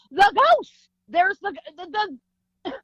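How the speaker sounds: loud high cry breaking into stammer; frantic insistence. Retold or imitated. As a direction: cry out, then get stuck on the word. Insisting to doubting dad.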